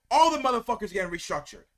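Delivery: ordinary delivery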